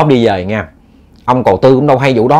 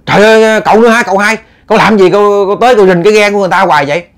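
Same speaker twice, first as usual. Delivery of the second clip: high, loud, fast alarmed cry at the intruder; servant shouting; 8 semitones higher, 4 dB louder, faster than usual. Acted. Servant cries out at the man lurking by the boat, high and loud. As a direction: yell it out in alarm to chase him off. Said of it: "Cổ la lên".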